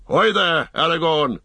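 high, loud hail; shout. A narrator's reading. Boromir hails the leader's boat, shouting. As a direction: call out sharply to someone ahead. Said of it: "shouted Boromir".

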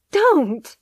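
loud high cry of protest. Acted. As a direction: shout it out, pleading. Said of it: "she cried".

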